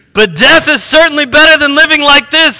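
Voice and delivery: a mild despairing outburst; exclaimed. A narrator's reading. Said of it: "he exclaimed".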